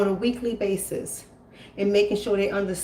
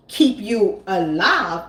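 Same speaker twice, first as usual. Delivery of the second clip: slowed emphasis; 3 dB louder, much slower than usual. Real, not acted.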